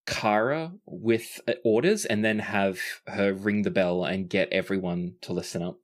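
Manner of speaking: ordinary delivery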